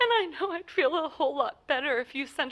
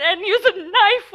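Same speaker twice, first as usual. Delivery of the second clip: louder, slower, breaking into a frightened half-sob; 5 semitones higher, 7 dB louder than usual. Acted. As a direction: let the fear crack through on the knife. Terrified caller describing the plot.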